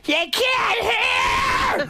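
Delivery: high, slow drawn-out yell; mock yell. Comedian imitating his mother yelling at deaf dad, high slow shout. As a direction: stretch out the shout like an angry old woman.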